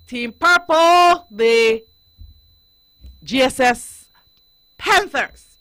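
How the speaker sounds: loud, high, drawn-out team call; rousing team announcement. Pretend. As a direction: shout out the team names like an announcer. Announcing the teams to the audience.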